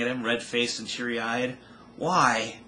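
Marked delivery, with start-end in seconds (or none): 2.0-2.6 pleading. A narrator's reading. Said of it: "she pleaded".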